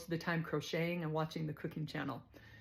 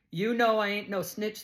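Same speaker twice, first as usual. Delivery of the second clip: louder, higher playful refusal; sassy retort; 4 semitones higher, 6 dB louder than usual. Retold or imitated. Too Tall teases the lieutenant, loud and sassy. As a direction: throw it back at him with sass.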